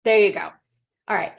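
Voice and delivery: ordinary talk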